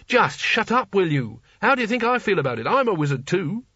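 high, forceful exasperated outburst; snapping. A narrator's reading. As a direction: snap at him, pitch jumping up. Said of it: "Rincewind snapped".

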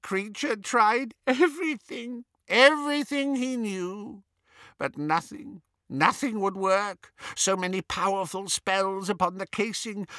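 high, miserable elf voice; moaning house-elf. A narrator's reading. Elf listing his failed attempts, moaning. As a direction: lament it brokenly, with halting pauses. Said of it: "moaned the elf".

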